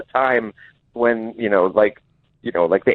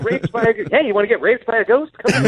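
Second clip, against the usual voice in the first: high, fast carnival barker; high mock barker; 10 semitones higher, faster than usual. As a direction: lure them in, hammed-up barker. Mock ghost-hotel pitch.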